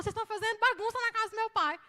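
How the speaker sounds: loud, high, rapid outburst; angry rebuke. Acted. Preacher voices Jesus clearing the temple, angry shout. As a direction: raise the voice in fast, forceful indignation.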